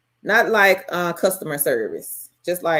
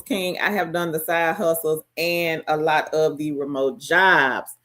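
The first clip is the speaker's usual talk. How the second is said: usual speaking voice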